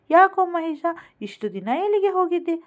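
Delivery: high, loud, delighted call of his name; glad surprise. Acted. Mother spots long-missing Mahesh on the bench, glad surprised call.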